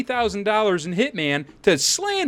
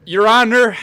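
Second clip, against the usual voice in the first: loud call; 5 semitones higher, 7 dB louder than usual. Acted. Calling the judge again.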